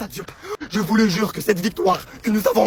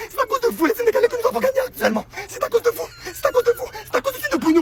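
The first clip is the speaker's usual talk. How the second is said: exalté; 10 semitones higher than usual. Real, not acted. Remerciements exaltés aux Sénégalais.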